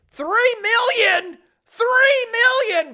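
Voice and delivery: high, drawn-out excited shout; top-of-lungs yell. Retold or imitated. Girl shouting after the puppeteer walking away, at the top of her lungs. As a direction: imitate a girl yelling at full voice. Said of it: "at the top of her lungs goes".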